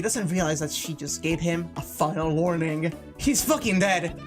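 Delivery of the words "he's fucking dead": normal talking voice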